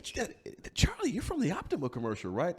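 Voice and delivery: real estate voice. Acted. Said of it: "I put my real estate voice on".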